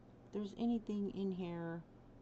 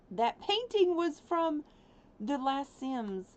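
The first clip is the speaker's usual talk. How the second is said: higher, louder, slow realization; surprised; 7 semitones higher, 6 dB louder, slower than usual. Real, not acted. Surprised realization about painting.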